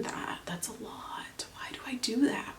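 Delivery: hushed unvoiced self-scolding; whisper to herself. Real, not acted. Whispering to herself over too much concealer. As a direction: breathe it under your breath like a private scold. Muttering to herself while applying.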